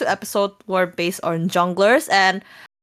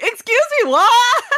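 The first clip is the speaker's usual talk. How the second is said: very high-pitched, loud disbelief; hammed-up incredulous shriek; shocked outburst; 14 semitones higher, 5 dB louder than usual. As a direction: squawk it in mock outrage, ending louder. Retelling their own shocked reaction.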